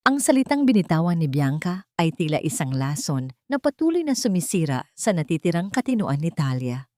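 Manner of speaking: ordinary delivery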